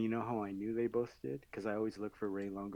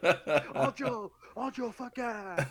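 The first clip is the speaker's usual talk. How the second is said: hyped shout; 9 semitones higher, 5 dB louder, much slower than usual. Retold or imitated. Fighter hyped, calling the name.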